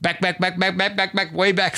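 a mock sportscaster call, fading away; Chris Berman impression. Host mimics a sportscaster's home run call, lightly. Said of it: "way back with Chris Berman".

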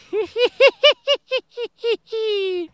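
high, loud sinister giggle; creepy clown cackle. Retold or imitated. Clown laugh after balloon line.

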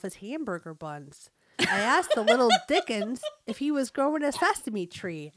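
animated Fargo-style North Dakota mom; North Dakota accent. Acted. Mom telling on her little boy, North Dakota accent. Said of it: "read with a North Dakota accent".

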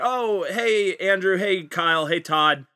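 high, put-on casual surprise; hammed-up surprise. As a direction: act like you just noticed it. Acted basement scenario, fake surprise.

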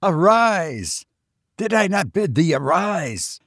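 high, insistent command from a fiend; impatient gibbering voice. A narrator's reading. Unseen fiend orders the dreamer to rise, impatient high command. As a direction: command sharply, pitched well up. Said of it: "while gibbering the voice said again".

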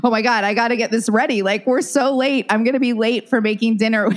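fast, frazzled rush of words; retold hurried fretting. Cook running late for ancestors' dinner, comically frazzled. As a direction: fret quickly, on the edge of laughing. Acts out rushing to make dinner.

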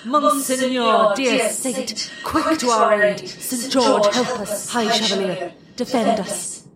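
high whooping hurrahs easing into invocation; battle cry. A narrator's reading. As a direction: cry out to the saint like a distant host. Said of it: "the Latin-bred soldier heard the cry".

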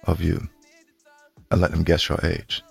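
usual speaking voice

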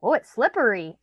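put-on high, loud sing-out of a child's stock phrases; imitating child's script. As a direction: mimic a kid's echoed fuss after a bump. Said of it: "he had bumped his head and he would go".

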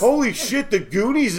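surprised. Real, not acted. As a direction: blurt it out in surprise. Surprised at search result.

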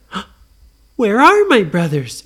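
loud, high, alarmed question; gasp, then blurt it out high; mock-startled little girl; startled Nora. Nora notices brothers missing.

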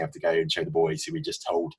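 usual speaking voice

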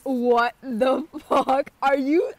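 real shock at the photo; stunned disbelief. Real, not acted. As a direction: let the shock stretch every word.

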